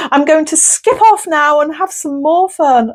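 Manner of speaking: high, giggly camp voice, half-laughing; coy, flattered and tickled pink; bashful John Inman. Retold or imitated. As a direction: camp spirit guest blushing at the compliment, high and giggly. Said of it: "He's saying".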